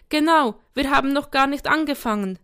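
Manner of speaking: indignant. A narrator's reading. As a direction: protest like an outraged little boy. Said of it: "empörte sich Sam".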